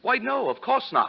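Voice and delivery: a startled yelp of denial; flustered denial. Acted. Kavanaugh denying to Spade, flustered and high. Accused of suspecting his stepson.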